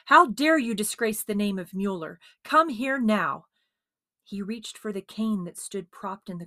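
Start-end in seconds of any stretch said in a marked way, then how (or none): 0.1-3.5 loud, higher furious outburst; exploding in anger. A narrator's reading. Father explodes at his thieving son, furious and loud. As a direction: let the rage burst out. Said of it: "his father exploded".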